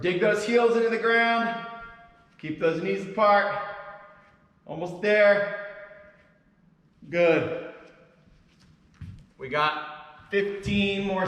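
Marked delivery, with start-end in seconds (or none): 0.0-7.7 louder, higher coach push; loud coaching cue. Real, not acted. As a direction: pump the class up through the last reps. Coach pushing class through set.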